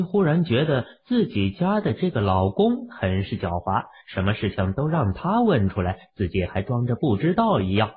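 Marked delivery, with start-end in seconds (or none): none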